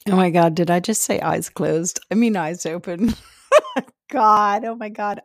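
loud, laughing oops at your own slip; amused dismay. Real, not acted. Catches own slip, laughing at herself.

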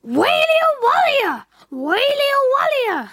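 put-on high baby wail; howling baby. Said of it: "the baby still howled with hunger".

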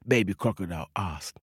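ordinary delivery

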